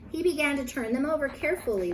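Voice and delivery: ordinary delivery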